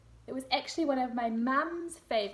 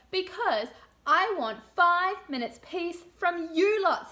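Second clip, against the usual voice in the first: slow, louder fed-up mother; exasperated mum; 7 semitones higher, 10 dB louder, slower than usual. Acted. Mum telling her children again why she wants peace, slow and fed up. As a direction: spell it out like a worn-out mum. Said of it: "said Missus Large".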